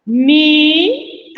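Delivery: "Mi" alone, loud, long and rising; sounded-out syllable. Shown as a demonstration. Teacher counting out the second syllable, "Mi" held loud. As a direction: stretch "Mi" out as its own syllable. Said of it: "a segunda sílaba".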